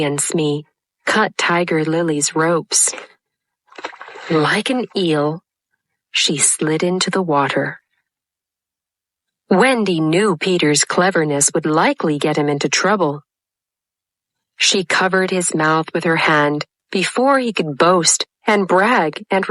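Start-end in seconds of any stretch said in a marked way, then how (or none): none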